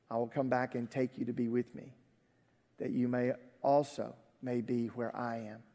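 low, soft reading of scripture; solemn scripture reading. A narrator's reading. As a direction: read Jesus's words quietly and solemnly.

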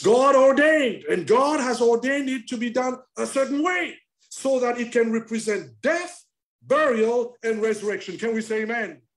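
loud, high rhythmic build; preaching at full force. Acted. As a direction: drive each phrase home to the congregation. Preacher declaring holiness of baptism.

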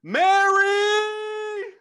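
high, loud cry for help from the soul; desperate scream. Retold or imitated. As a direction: scream the name out with everything you've got. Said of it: "I screamed out".

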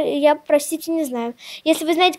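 ordinary talk, nothing marked

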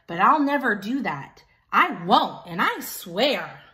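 hammed-up toddler tantrum over toys; Pig's tantrum. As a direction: Pig refuses to share, loud mock tantrum. Pig voiced in tantrum.

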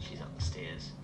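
hushed unvoiced whisper. Real, not acted. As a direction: whisper so she won't hear. Hiding from monster.

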